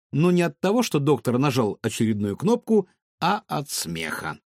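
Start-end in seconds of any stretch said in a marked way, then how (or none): none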